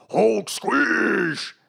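loud, high, stretched-out battle cry; yelled Hulk roar. Acted. She-Hulk yelling after transforming, huge drawn-out yell. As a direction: bellow it and drag out the last word. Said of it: "And yells".

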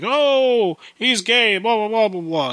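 high-pitched mimicry of gossiping people; exaggerated teasing voice, falling away into blah-blah; mock jeering.

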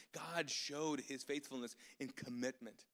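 ordinary delivery

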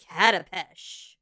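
normal talking voice

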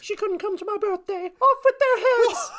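high, loud mock British queen voice, laughing; a hammed-up British queen voice that cracks into laughter; terrible British accent. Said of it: "That is a terrible British accent".

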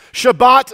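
"Shabbat" called out high and loud, shuh-BAHT; modeled Hebrew word. Shown as a demonstration. Preacher leads congregation in a fun Hebrew word, "Shabbat" called out loudly. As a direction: sound out "Shabbat" boldly for a crowd to echo. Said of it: "Say Shabbat".

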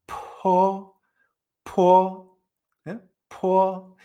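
"Po" with air released before the vowel; aspirated p. Shown as a demonstration. Teacher advising learners to release air first, aspirated demonstration. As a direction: puff on each p of "Po", then a level held vowel. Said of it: "que saquéis el aire antes".

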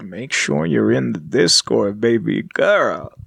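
low, slow, hammed-up smooth talker; mock sultry plug, drawn out low and slow; mock seductive. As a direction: stretch out a playful come-on in a low voice. Playful plug to the listeners.

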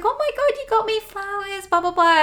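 high, gushing mum voice; excited mum. Retold or imitated. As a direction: squeal it like a delighted mum. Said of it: "And she's like".

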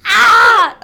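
very loud, high "ah"; sudden shout. Real, not acted. Host breaking off laughter before the intro, sudden shout. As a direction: burst out with a short, high yell. Outburst while trying to start show.

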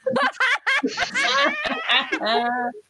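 high, helpless laughing fit; burst of laughter. Real, not acted. Player cracking up at the game table, helpless laughter.

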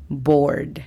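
"bored" said clearly with English r and d; bored model. Shown as a demonstration. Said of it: "La pronunciación fonética es".

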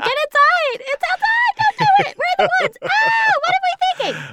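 very high, loud frantic yelling; hammed-up terror, shrieking from the porch; panicked mock shriek. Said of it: "And they'll be like".

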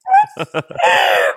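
cringing squealed laugh; embarrassed squealing laugh. Real, not acted. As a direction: shriek with embarrassment. Embarrassed about note.

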